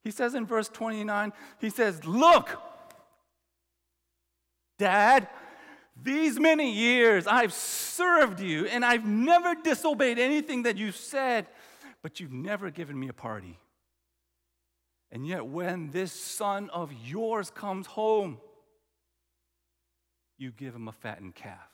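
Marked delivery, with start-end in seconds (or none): 2.0-11.5 put-on angry older brother. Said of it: "look how angry he is". 12.0-21.7 quieter, bitter complaint, dropping low at the end; complaining older brother. Retold or imitated. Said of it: "he complains".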